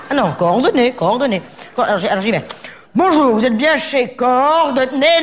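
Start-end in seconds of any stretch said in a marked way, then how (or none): 2.9-5.2 slow grand greeting. Acted.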